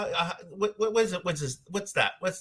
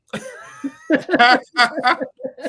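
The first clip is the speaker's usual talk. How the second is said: laughing; 9 semitones higher, 4 dB louder than usual. Real, not acted. Laughing at own mock fear.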